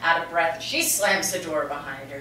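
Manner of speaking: usual speaking voice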